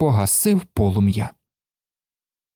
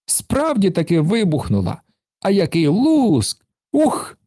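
high, gleeful exclamations; thrilled; 7 semitones higher than usual. A narrator's reading. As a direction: revel in the bang. Karlsson thrilled by explosion. (захоплено, з вигуком «Ух!»)

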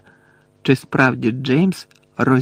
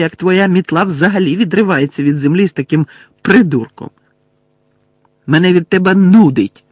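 high, loud scornful rant; Lily berating James, furious and scornful; angry tirade; 6 semitones higher, 7 dB louder than usual. A narrator's reading. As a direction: pour out contempt.

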